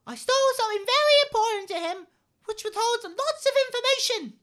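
very high, loud puppet voice; squeaky little red monster; Elmo voice. Acted. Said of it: "we just had Elmo".

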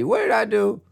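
ordinary delivery

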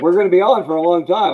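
high, loud, quick imitated protest; loud retold reply. Guest imitating the other man about show length, loud and high.

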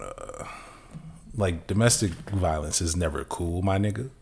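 ordinary delivery

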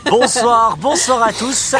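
high, loud cheerful hello; excited greeting. Real, not acted. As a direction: greet the listeners with a burst of energy. Host greets listeners. (salut enthousiaste)

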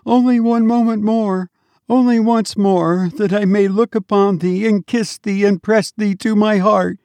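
put-on high, pleading mother begging her child to stay; imploring mother. A narrator's reading. Grieving mother to her dead child, high imploring plea. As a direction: hold on to him with your voice. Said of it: "implored the mother".

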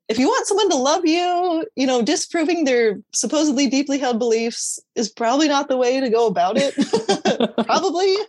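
high-pitched, amused at herself; rueful amusement rising into a laugh; cracked up. Real, not acted. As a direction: let real laughter break in toward the end. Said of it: "it just cracks me up".

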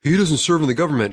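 mocking quote. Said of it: "people are like".

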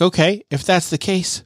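high, strained, upset voice; upset pleading. Acted. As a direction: plead with rising distress. Zeke's upset outburst acted.

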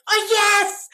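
high, loud burst of joy; thrilled shout. Retold or imitated. Hearing from the doctor it's a boy, joyful shout. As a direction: cheer it out in delight.

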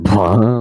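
loud imitated dad sneeze. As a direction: blurt out a loud mock sneeze. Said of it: "when they sneeze, like".

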